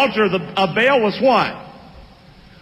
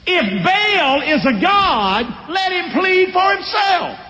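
high, slow shouted challenge; defiant shout; 8 semitones higher, slower than usual. Acted. Joash defying the mob over Baal, slow high shout. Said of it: "Daddy talking crazy".

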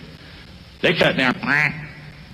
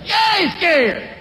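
preaching shout; 9 semitones higher, much slower than usual. Acted. Pastor crying out to the congregation in a high, slow shout. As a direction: cry it out to the congregation.

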